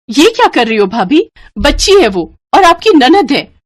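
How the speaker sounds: loud, high angry rebuke; angry protest. Acted. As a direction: confront her in anger. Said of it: "उसने गुस्से से बबीता से कहा". (गुस्से से टोकते हुए)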